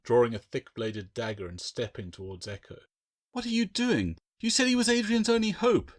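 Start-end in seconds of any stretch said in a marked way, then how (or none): 3.3-5.9 much higher, alarmed shout; Claire shouting. A narrator's reading. Claire blocking Bill's path with the dagger, alarmed shout. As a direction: shout to stop him. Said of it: "Claire shouted".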